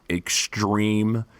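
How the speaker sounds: ordinary talk, nothing marked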